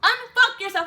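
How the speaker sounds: high, very loud yell; hammed-up screamed order; drill sergeant. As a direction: imitate a sergeant yelling at a private. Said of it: "My drill sergeant said".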